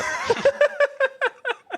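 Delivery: burst of laughter. Real, not acted. Laughing after reading awful lyrics.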